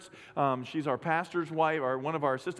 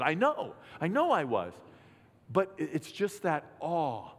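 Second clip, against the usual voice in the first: ordinary talk, nothing marked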